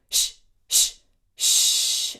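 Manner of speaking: loud drawn-out unvoiced shh; long shh. Shown as a demonstration. Teacher demonstrating a slow breath shh for the choir. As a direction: let one long hiss flow out. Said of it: "we're gonna do a long shh".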